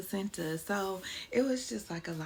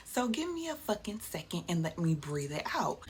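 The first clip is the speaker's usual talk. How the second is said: ordinary talk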